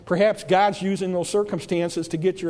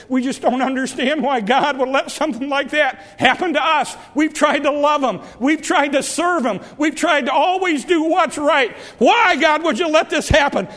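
pleading shout; 5 semitones higher than usual. Acted. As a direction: demand an answer from heaven at full voice. Preacher voicing complaint to God.

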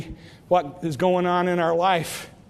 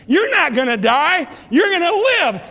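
rising exclamation; 7 semitones higher, 4 dB louder than usual. Real, not acted. Preaching climax.

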